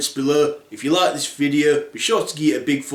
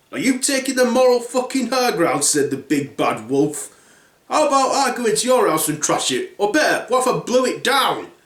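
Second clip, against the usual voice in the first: raised, mocking wolf voice; lightly voiced indignant wolf; 7 semitones higher than usual. Wolf mocking the third pig through the window, indignant. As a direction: sneer back at the smug pig.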